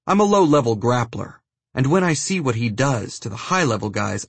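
plain reading voice

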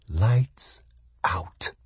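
soft, menacing whisper; hushed whisper with a pause between the words. A narrator's reading. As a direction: whisper it, one word at a time. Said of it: "it whispered".

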